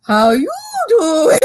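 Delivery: high, slow, drawn-out mimicry; imitated catchphrase. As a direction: mimic a friend's exaggerated pick-up line. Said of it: "she was just like".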